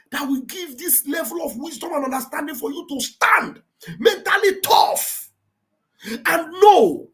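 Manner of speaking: loud, slow preaching, rising to cries; fiery preaching. Acted. Host preaching to Biafran listeners, fiery and impassioned. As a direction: preach it hard, hammering the same God. Preaching at full force about God.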